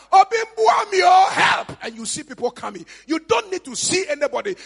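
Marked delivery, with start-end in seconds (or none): 0.1-1.8 high, drawn-out loud cry for rescue; shout for help. Acted. Victim calling out when attacked, shouting for help. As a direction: yell out as if calling the neighbours. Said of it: "it is one shout".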